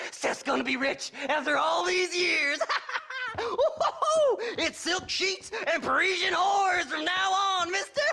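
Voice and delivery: cackling, shouted triumph with laughter; gleeful whooping. Acted. Grave robber gloating over treasure, whooping with laughter. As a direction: whoop and laugh like you've struck it rich.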